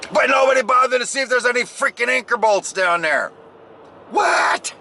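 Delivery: high exasperated rant at his crew; reliving exasperation. Retold or imitated. Retelling the crew's blunder, high exasperated outburst. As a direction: let the old frustration boil back up. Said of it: "I'm reliving the moment right now".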